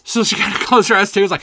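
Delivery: usual speaking voice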